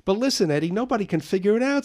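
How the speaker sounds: high, loud and fast; boy insisting to his friend; eager excitement. Acted. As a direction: rush it, pleading for attention. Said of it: "said Carl".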